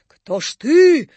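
loud, high, drawn-out question; shouted demand. A narrator's reading. Napoleon demands the ghost's name, loud slow shout. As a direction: cry out the last word at the phantom. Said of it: "кричал Наполеон".

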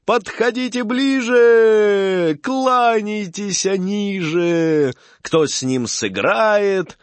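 high, slow fairground barker cry; booming circus ringmaster. Acted. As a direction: call the crowd in, drawn out. Barker invites fair crowd.

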